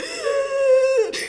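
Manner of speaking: loud, high mock gasp of panic; hammed-up horrified gasp; panicked gasp. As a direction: gasp as if waking in sheer panic. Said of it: "woke up that morning in sheer panic".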